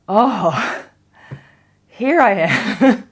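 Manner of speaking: loud, slow, drawn-out wonder; high, laughing burst of discovery; amazed realisation. Acted. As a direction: arrive with big delighted surprise. Acts out arriving, amazed.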